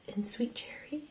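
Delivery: soft whisper of the shade name. Real, not acted. Product name whispered, repeated plainly.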